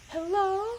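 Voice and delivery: very high, drawn-out flirty greeting; put-on sultry woman in falsetto; sexy female voice. Shown as a demonstration. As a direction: try a sexy feminine lilt. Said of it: "How do I do a sexy vo".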